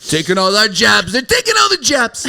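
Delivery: high-pitched mock politician rant; Donald Trump. Man mocking Trump on jobs, rough impression. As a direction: do an amateur Trump, pitch climbing. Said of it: "That's not a bad Trump".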